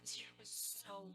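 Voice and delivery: whispered, heartfelt aside; hushed complaint; emphatic whisper. Real, not acted.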